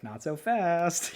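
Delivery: slow mock taunt. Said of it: "and you're like".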